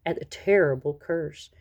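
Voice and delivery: plain reading voice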